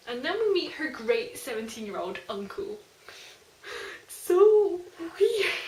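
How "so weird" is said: loud, high cry of disbelief; weirded out. Real, not acted. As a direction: blurt it out, totally thrown. Reacting to seventeen-year-old uncle.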